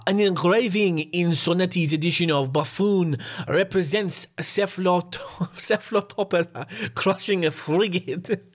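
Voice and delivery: badly faked French accent, close to cracking up; attempted French accent. Podcaster quoting French writer Victor Hugo, faltering French accent. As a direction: stumble through it in a hammed-up French accent. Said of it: "I can't do the accent".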